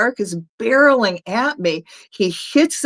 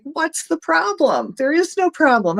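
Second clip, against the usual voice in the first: dismissive retort; 9 semitones higher than usual. Retold or imitated. Retold argument, man shrugs off danger.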